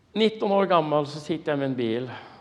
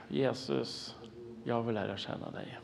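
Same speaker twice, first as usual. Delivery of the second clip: quiet, earnest plea to Jesus; soft prayer; 10 dB quieter than usual. Retold or imitated. Young believer praying to Jesus, softer earnest plea. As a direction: say "Jesus, jag vill lära känna dig" softly, as a heartfelt prayer. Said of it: "det här var mitt rop".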